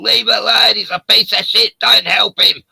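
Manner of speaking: loud, high shouting crowd; retold crowd yelling. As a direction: yell it like an angry mob. Crowd outside pub yelling.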